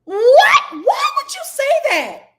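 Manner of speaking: loud high disbelieving yell; baffled shout. Real, not acted. Reacting to the sister's remark, loud and high. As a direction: shriek out your disbelief. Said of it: "Baffled me".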